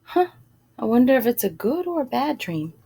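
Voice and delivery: plain reading voice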